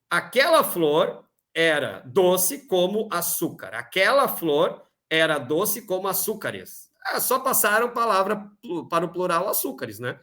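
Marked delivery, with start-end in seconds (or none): 0.1-3.8 "Aquela flor era doce como açúcar" read slowly and deliberately; slow reading aloud. Shown as a demonstration. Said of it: "Vamos para a letra D". 3.8-6.9 "açúcares" in the sentence read slowly and clearly; slow reading aloud. Shown as a demonstration. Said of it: "Vamos para a letra D".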